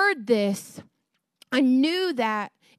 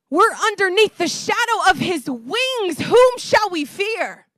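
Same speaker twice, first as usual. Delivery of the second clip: higher, forceful preaching voice; fervent preaching; 6 semitones higher, 5 dB louder than usual. Real, not acted. Preacher assuring the church under the shadow of his wings, fervent. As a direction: proclaim it with bold, ringing conviction.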